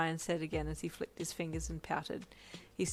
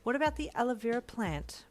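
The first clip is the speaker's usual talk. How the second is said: sassy comeback, higher and louder; 6 semitones higher, 5 dB louder than usual. A narrator's reading. Boyfriend defending his plant care, sassy. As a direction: throw it back with sass. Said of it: "Brian asked in a sassy voice".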